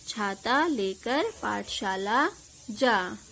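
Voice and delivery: slow, clear dictation-style reading. Shown as a demonstration. Teacher reading the finished sentence slowly to pupils. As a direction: read the sentence slowly for children.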